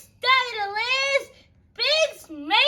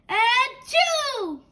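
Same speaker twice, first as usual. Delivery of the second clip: mock sneeze; 4 semitones higher than usual. Gerald sneezes at the doctor.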